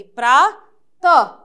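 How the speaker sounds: प्रा and त said apart, loud, with a pause; syllable by syllable. Shown as a demonstration. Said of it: "ह की आवाज आएगी".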